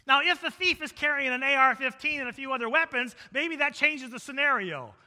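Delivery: loud, high sermon delivery; forceful preaching. Real, not acted. Preacher explaining nighttime and daytime thieves to congregation, forceful preaching. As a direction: preach it out at full volume.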